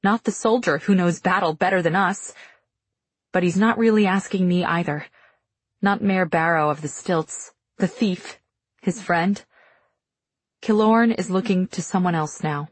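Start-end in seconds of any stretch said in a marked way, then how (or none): none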